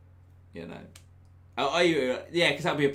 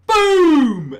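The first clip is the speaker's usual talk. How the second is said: much higher, louder yelled boom; catchphrase shout; 18 dB louder than usual. Retold or imitated. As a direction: bellow it like a bid catchphrase. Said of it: "he used to go".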